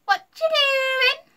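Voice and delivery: put-on very high, loud little-girl squeal; piercing, drawn-out nosy squeak. A narrator's reading. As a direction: squeak it out, stretching the words. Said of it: "squeaked Ella".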